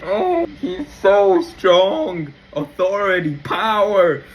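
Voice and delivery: long amazed exclamation; drawn-out awe. Real, not acted. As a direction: savor each word in wonder. Gushing praise after story.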